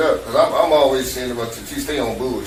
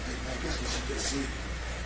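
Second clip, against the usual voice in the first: retold whisper, softer and a touch faster; hushed; 12 dB quieter, faster than usual. Telling wife quietly, daughter upstairs.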